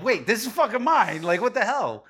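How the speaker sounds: loud, high outraged outburst; re-enacted indignant protest; indignant disbelief. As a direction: call out the theft in disbelief. Recounts catching friend with stolen cards.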